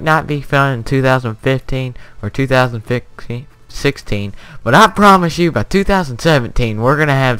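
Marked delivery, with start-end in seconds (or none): 4.6-7.4 excited. Real, not acted. Excited promise to viewers.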